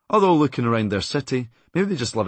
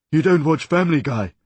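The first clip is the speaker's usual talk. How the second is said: loud, higher shouting cartoon character; shouted cartoon voice; 6 semitones higher, 6 dB louder than usual. Impressionist shouting at the comic, loud cartoon voice. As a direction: yell it as an outraged cartoon figure. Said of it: "he shouted ... the voice of another Family Guy character".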